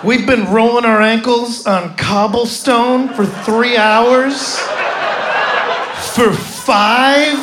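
high, loud, slow disbelief; indignant outrage. Retold or imitated. Comic ranting to his friend about the tour, slow loud outrage. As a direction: rant at the pitiful count. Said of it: "I had to turn to my friend".

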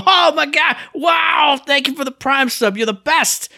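high mock-ecstatic shout of thanks; overjoyed streamer squeal, easing down as it goes; gushing excitement. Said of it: "I smile and I go".

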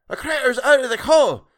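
high, loud alarmed shriek; piercing cry of alarm. A narrator's reading. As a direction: shriek the warning at full pitch. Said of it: "shrieked someone".

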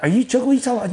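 high, incredulous questioning; shocked disbelief. Retold or imitated. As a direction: act out friends taken aback. Friends shocked at kill plan.

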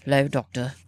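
low, put-on British accent; Xavier's accent. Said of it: "in his accent".

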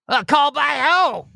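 high, puzzled "called by who?"; mock Giuliani confusion.

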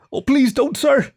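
high, frightened begging; wincing plea. A narrator's reading. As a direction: shrink from a blow and beg. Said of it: "Kieran winced".

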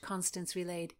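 ordinary delivery, nothing marked

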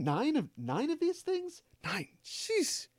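incredulous high-pitched mock reaction, slow; hushed, high, baffled impression; Rainn Wilson. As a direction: squeak it out softly in mock disbelief. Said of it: "Rainn Wilson is gonna be in, like".

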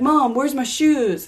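put-on whiny boy voice; son's voice. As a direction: play the son, slow and high. Son complaining about missing shoes.

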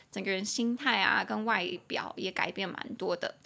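normal talking voice

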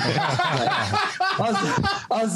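high squeal of a laugh through the words; real laugh breaking up the words. Real, not acted. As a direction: crack up mid-sentence, slow. Laughing at memory.

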